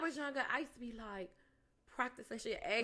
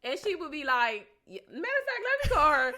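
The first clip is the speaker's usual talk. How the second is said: louder laughing, excited; laughing, building to loud; 8 semitones higher, 9 dB louder than usual. Real, not acted. Laughing about calling Yazzie.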